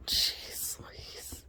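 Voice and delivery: breathy whispered sigh of words; whispered exasperation. Real, not acted. Fed up with the begging puppy, a whisper. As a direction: whisper it, worn out.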